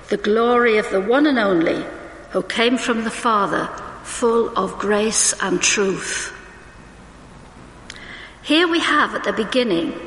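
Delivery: ordinary talk, nothing marked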